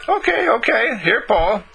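put-on high, eager little girl. Mary giving Paul the flag, high little girl voice. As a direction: chirp it like a small girl handing over a flag.

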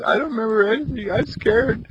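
put-on high, small frightened child's voice; a lost boy admitting he's afraid; little boy telling the man in the wheelchair he's scared.

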